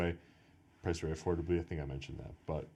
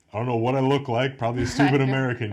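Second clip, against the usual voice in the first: loud; 7 semitones higher, 12 dB louder, faster than usual. Real, not acted. Laughing at himself.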